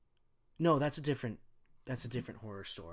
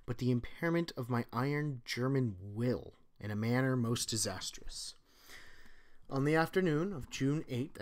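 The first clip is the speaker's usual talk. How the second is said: steady, dramatic story reading; story narration; 7 dB louder than usual. Acted. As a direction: read it like a doomed officer's confession. Reads Lovecraft story aloud.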